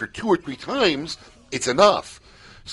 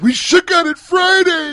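high, loud, drawn-out mock cry; mimicked distraught wail; 8 dB louder, slower than usual. Host teasing woman about her truce plea, mock wailing. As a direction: exaggerate the plea into a slow wail.

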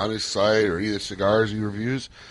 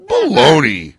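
loud scoff; 9 semitones higher, 13 dB louder, much slower than usual. Real, not acted. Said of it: "arguing over the pronunciation".

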